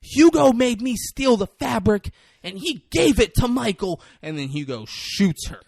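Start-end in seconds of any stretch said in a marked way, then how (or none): 0.0-4.0 high, loud mock-female outburst; defiant accusation. Emily exposes Hugo to everyone, defiant accusation. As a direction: blurt out the accusation at full volume, pitched up.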